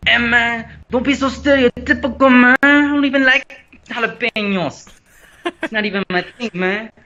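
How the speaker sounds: high, loud hammed-up fake Mexican accent. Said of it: "the fake one that no one actually speaks".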